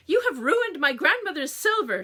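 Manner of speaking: loud, high wailing complaint; hammed-up mother mourning her silver. A narrator's reading. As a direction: wail it like a tragedy. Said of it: "she wailed".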